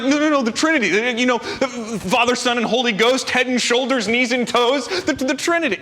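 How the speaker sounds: high, urgent "no, no, no, the Trinity"; a flustered student pleading to be understood; frantic explaining. Retold or imitated.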